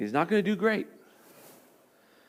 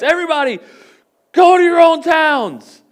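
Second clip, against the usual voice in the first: dad voice; 11 semitones higher, 11 dB louder than usual. Retold or imitated. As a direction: send the kids off like a tired dad. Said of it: "He got his dad voice out".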